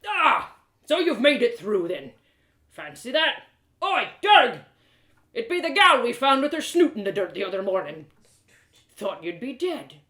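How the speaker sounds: put-on loud, rough old smith barking at his apprentice; a burly smith losing patience, loud; gruff blacksmith, scolding. Blacksmith greets Allie.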